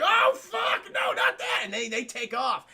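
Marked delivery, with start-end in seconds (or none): 0.0-1.6 very high, loud panicked shriek; mock panic. Raiders hearing the howl, high panicked shriek. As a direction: shriek like scared bandits.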